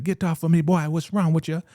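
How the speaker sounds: high, loud, offended voice pushing him away; indignant woman. Retold or imitated. Joan pushing off a man.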